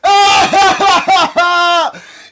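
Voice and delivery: a big shouted cackle of glee; loud gloating laugh. Real, not acted. Laughing at the video.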